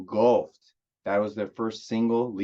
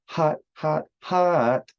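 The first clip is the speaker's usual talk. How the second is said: higher and louder, a silly put-on "hot, hot"; odd silly voice; 8 semitones higher, 3 dB louder, much slower than usual. Praising the opening track with a sudden silly high voice. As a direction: squeal out the words "hot, hot" for fun. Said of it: "I don't know why I said it like that".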